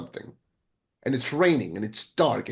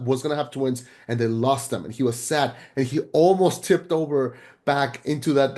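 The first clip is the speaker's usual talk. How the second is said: ordinary delivery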